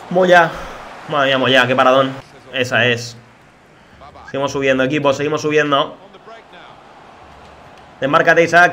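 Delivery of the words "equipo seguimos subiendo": ordinary talk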